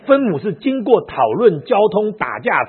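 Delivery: high, loud, slow word by word; slow emphatic listing. Real, not acted. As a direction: spell out each step, slowly. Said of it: "slow emphatic listing". (一字一顿地大声强调)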